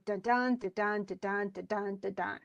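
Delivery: "da-dun" as a steady weak-strong beat; iambic rhythm. Shown as a demonstration. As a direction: chant "da-dun" evenly, landing on each DUN. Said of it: "That's what it really sounds like".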